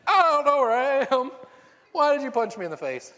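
high loud confused half-cry; mock bewildered wail. Parent punched awake cries out, loud mock wail.

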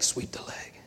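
whispered menacing command; breath-only mock villain whisper; sinister teacher. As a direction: imitate a cold karate sensei giving a hushed order. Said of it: "his teacher's like".

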